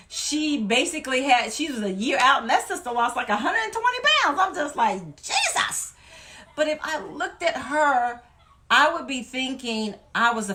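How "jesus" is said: breathless high whispered gasp of amazement; an airless, high-pitched Jesus of disbelief; stunned Jesus. Real, not acted. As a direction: let it slip out in awe. Stunned at another's big loss.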